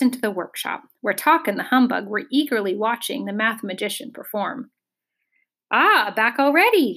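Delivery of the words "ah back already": higher-pitched, lightly exclaimed greeting; a touch of hearty greeting; friendly cry. A narrator's reading. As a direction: call out a cheerful welcome, mildly. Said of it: "he cried".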